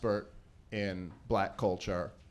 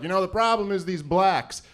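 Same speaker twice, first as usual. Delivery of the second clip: a hint of a bigot's rant, higher and louder; lightly acted complaint, raised in pitch and volume; 8 semitones higher, 6 dB louder than usual. Acts out a ranting bigot.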